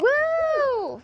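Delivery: high, loud whoop of glee; delighted cheering shout. Real, not acted. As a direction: whoop it up for the crowd. Cheering a sabotage reveal.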